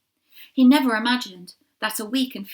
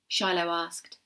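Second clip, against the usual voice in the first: ordinary delivery, nothing marked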